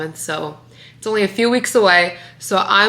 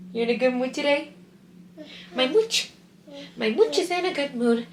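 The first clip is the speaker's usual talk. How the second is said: higher sing-song coo; 6 semitones higher, 5 dB quieter than usual. Real, not acted. Mother cooing at her cheerful baby, higher baby talk.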